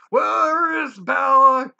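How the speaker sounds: a beast bellowing for Belle; creature roar. Acted. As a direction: roar it like a monster. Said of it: "the creature roared".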